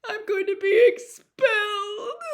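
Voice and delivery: high, loud, tearful wail; weeping. A narrator's reading. Ginny weeps to Harry about being expelled. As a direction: wail it through sobs. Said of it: "wept Ginny".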